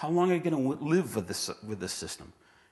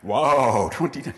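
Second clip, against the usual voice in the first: "Wow" burst out louder, then "twenty ten" dropping in wonder; mock awed wow; 9 dB louder, slower than usual. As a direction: gasp at "twenty ten" like it's magic. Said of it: "That's a really cool".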